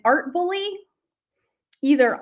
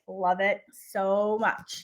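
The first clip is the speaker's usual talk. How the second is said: ordinary talk, nothing marked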